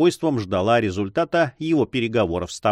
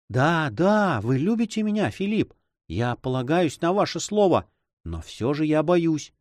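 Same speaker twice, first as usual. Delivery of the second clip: high-pitched anxious sweetheart; higher, worried lady's voice; loving, fearful heroine; 6 semitones higher than usual. Acted. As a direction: voice a woman trusting yet afraid.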